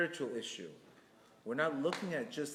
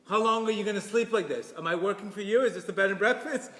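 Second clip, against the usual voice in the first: louder, higher mocking scold; nagging rebuke; 6 semitones higher, 6 dB louder than usual. Shown as a demonstration. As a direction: scold like a fed-up spouse. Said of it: "there's the New Jersey way".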